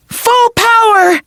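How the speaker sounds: battle cry. Acted. Fran unleashing her power.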